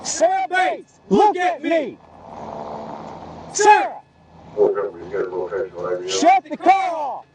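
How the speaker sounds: shouting commands. Real, not acted. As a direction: yell orders across the road. Officer shouting commands at suspect.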